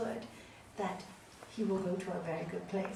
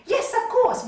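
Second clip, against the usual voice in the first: high, loud eager agreement; eager yes; 13 semitones higher, 13 dB louder than usual. Retold or imitated. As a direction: blurt it out without thinking. False mother eagerly agrees.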